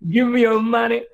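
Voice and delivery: high, laughing mock shout. Joking as Voldemort shouting at the heroine, laughing mock shout. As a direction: shout it half-heartedly through laughter. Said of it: "He then shouted".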